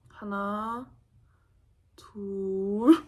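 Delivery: slow fading count; drowsy drawn-out count. Retold or imitated. Counting to ten as the anesthetic kicks in, drawn out and sleepy. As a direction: stretch the number out slowly as the drug takes hold.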